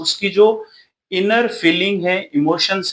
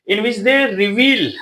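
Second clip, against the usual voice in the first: reads each English word out slowly for notes; slow dictation; 4 semitones higher, much slower than usual. Shown as a demonstration. Teacher dictating notes to students, slow and deliberate. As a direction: spell out the definition word by word. Dictating next part of note.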